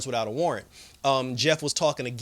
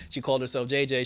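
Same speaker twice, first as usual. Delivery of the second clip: ordinary talk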